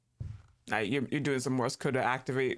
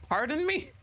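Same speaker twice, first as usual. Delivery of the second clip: high, jolted pardon me; startled pardon; 12 semitones higher than usual. Real, not acted. Streamer startled by something sudden in the game, high and surprised. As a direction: sound caught off guard.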